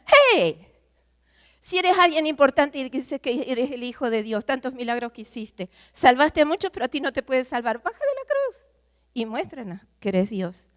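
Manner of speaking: high, loud jeer from the crowd at the cross; mocking shout. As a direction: yell the taunt up at the cross. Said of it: "Cuando nos gritan". (burla gritada desde la multitud al crucificado)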